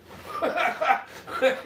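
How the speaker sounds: high, scoffing laugh; mocking laugh. Real, not acted. Man mocking those who expected government payment, laughing. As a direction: laugh at their naivety.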